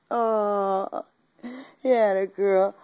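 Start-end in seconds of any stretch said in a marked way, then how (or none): none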